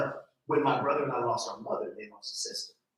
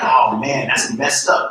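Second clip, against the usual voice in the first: mock dismay; 12 dB louder, slower than usual. Reacting to the phone mishap.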